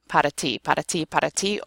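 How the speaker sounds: "pot of tea" run together as podda-tea; repeated fast drill. Shown as a demonstration. Teacher drilling the tap, "pot of tea" over and over as podda-tea. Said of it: "over and over again".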